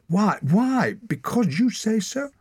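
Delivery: very high, loud challenge; outraged demand. A narrator's reading. Torak defying the Raven leader, high and loud. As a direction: fling it at him.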